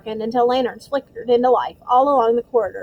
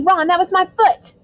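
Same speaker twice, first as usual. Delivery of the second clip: loud outraged cry; indignant yelp; 8 semitones higher, 5 dB louder than usual. Acted. Hermione stepped on.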